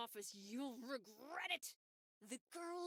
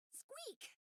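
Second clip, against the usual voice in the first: high squeak; 12 semitones higher, 3 dB quieter than usual. Acted. Mouse squeaking.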